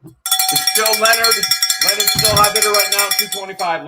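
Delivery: high, loud live bid calling; excited auction announcer, projecting over the room; auctioneer call. Real, not acted. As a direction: rattle off the bids with rising excitement.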